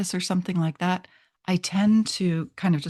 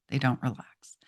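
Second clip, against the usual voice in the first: whispered aside; 4 semitones lower, 5 dB quieter than usual. Real, not acted. Aside about telling people relax.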